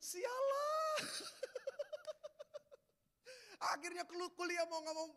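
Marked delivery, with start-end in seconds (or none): 0.0-1.0 high, slow laughing shout; real laughing shout over the memory; crying laugh. Real, not acted. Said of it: "Saya juga ikut nangis". 1.0-2.8 high shouting laugh; real loud laugh. Real, not acted. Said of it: "ya kan, sialah".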